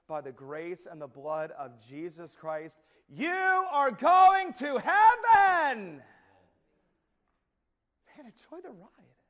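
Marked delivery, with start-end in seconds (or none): 3.1-6.0 high, loud, slow exultant shout; preaching at full force. Real, not acted. Preacher assuring believers of heaven, loud exultant shout. 8.1-9.2 much softer, higher, a hushed aside; soft echo. Real, not acted. Preacher echoing the story's punchline, soft and hushed.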